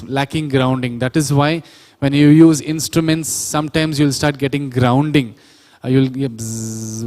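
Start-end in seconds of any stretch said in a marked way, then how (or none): 6.3-7.0 imitated electrical buzz; drawn-out bzzz like a ground hum; buzzing sound. Shown as a demonstration. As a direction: buzz like a faulty cable. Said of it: "will be a buzzing sound".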